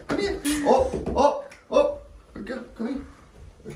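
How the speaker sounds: very high pet-coaxing voice; short, high calls to coax her up; coaxing the dog. Real, not acted. Dad urging the curled-up dog into the bath, high coaxing.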